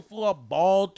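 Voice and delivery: ordinary talk, nothing marked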